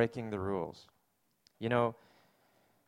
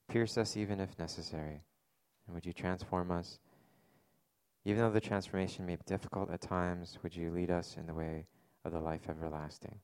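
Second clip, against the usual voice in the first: unhurried prayer voice that drifts softer; 5 semitones lower, 5 dB quieter than usual. Acted. Pastor praying for the congregation after the reading, in a soft, earnest prayer voice. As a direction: pray over the congregation with bowed head.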